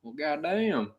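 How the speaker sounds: high mock Tennessee country drawl. Cohost riffing on country music in a Southern accent. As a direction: ham up a Grand Ole Opry twang.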